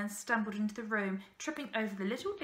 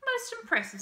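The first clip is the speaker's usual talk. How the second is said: high, louder lordly approval; condescending praise; 13 semitones higher, 5 dB louder than usual. A narrator's reading. Man responds to boy's boasting after a gap, condescending praise. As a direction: praise him as the one in charge. Man pauses, then shows he's in charge.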